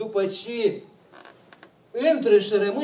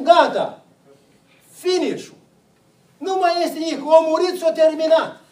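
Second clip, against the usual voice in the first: dramatic finality; 6 semitones higher, 5 dB louder than usual. Acted. Mocking how people talk about death.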